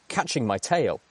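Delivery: plain reading voice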